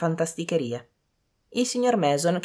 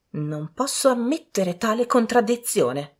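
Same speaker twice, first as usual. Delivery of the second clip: higher, slow dowager; disapproving widow; 7 semitones higher than usual. A narrator's reading. Widow echoing Lady Lynn, slow disapproving voice. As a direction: object slowly in a raised pitch. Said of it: "fece eco la vedova Ingram".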